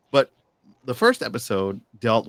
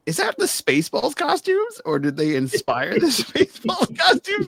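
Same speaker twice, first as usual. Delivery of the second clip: incredulous; 4 dB louder than usual. Retold or imitated. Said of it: "It was like".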